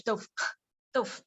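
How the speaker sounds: 豆腐 said like an English word, no tones; mock an English speaker's 豆腐; imitating a foreign learner's mistake; English-style reading. Shown as a demonstration. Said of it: "do not read it like English".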